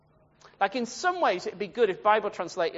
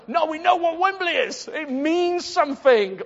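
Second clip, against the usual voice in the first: higher, louder mock indignation; emphatic objection; 9 semitones higher, 6 dB louder than usual. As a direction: protest as if it were obvious. Acts out everyone objecting.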